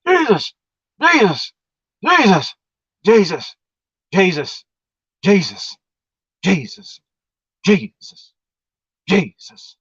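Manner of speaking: high, gasping cries of Jesus, fading down; desperate pleading. Retold or imitated. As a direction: call out the name breathless, fighting for air. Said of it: "I started invoking the name of Jesus".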